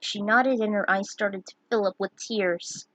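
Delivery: ordinary delivery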